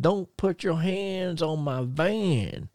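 slow, high, put-on Chattanooga Tennessee country accent; Terry Gordy's Tennessee accent. Terry Gordy guarding his van from Mercer, slow Tennessee country accent. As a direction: drag it out in a thick Tennessee country drawl. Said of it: "real country Ch-, uh, Chattanooga, Tennessee, uh, accent".

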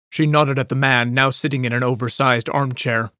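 ordinary delivery, nothing marked

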